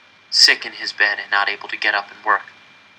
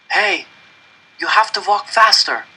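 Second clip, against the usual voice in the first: urgent urging; 8 semitones higher, 3 dB louder than usual. A narrator's reading. Urging a failing boy on.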